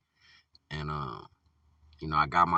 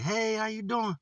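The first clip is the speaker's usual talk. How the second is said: bright, much higher cheery hello; excited greeting; 3 dB louder than usual. Retold or imitated. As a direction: mimic the store lady gushing hello. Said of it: "she was all excited".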